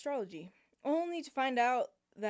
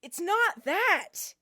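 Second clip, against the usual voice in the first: flustered; 8 semitones higher, 9 dB louder, much slower than usual. Acted. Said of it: "with a slight blush on his face".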